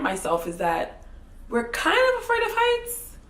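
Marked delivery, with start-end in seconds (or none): 1.5-3.2 very high, loud comic squeak; mock sheepish squeal. Storyteller admitting a fear of heights, mock sheepish squeal. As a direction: confess it in a squealed, put-on high voice.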